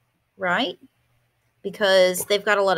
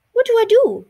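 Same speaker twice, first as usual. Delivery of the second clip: much higher and louder, giddy; excited panic; 7 dB louder than usual. Acted. Martina flustered over the adorable mouse, squealing.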